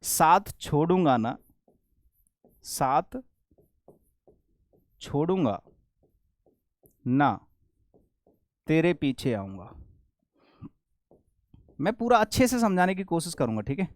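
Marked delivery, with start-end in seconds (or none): 0.0-9.7 slow, low, word by word with long pauses; writing-out pace. Shown as a demonstration. Teacher writing an example for students, slow dictation. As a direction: read it out in pieces, as if writing.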